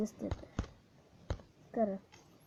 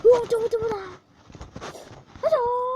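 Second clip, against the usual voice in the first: sudden loud yell at the screen; startled shout; 11 semitones higher, 9 dB louder than usual. Real, not acted. Gamer yelling at the game in alarm.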